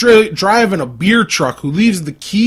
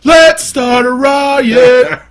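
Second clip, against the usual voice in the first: mock rally cry; 7 semitones higher, 6 dB louder, much slower than usual. Chanting a riot call.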